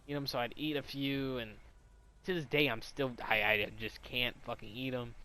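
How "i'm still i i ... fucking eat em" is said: ordinary talk, nothing marked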